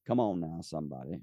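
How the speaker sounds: ordinary delivery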